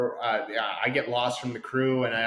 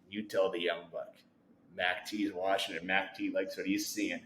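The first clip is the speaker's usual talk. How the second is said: much lower, softer, serious veteran; gruff coach voice; 7 semitones lower, 10 dB quieter than usual. Retold or imitated. Mac T passing a serious message to the young buck, low and grave. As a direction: deliver it like a grave scout's verdict.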